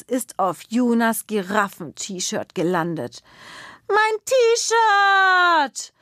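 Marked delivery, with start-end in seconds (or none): 3.9-5.9 hoher, lauter Kinderschrei; schreit. Acted. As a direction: entsetzt aufschreien, hoch und laut. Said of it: "schreit Juna". (Kind schreit wegen des Eisflecks auf, hoch und laut)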